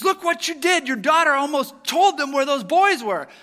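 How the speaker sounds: angry reproach. Retold or imitated. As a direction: blame the mother at full volume. Family argues at dinner.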